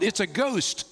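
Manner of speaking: mildly fearful cry. A narrator's reading. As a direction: read it as a startled outcry, understated. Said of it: "cried out in fear".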